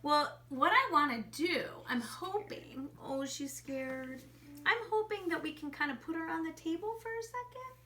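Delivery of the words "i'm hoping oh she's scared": hushed so the bunny isn't startled; whisper. Real, not acted. Teacher hushing near a scared bunny, whispering. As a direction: breathe it, slowly.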